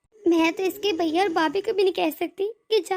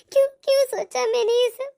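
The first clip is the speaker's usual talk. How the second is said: tearful why. Acted. Bride regretting she doubted her brother, crying out. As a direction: break into tears on the word.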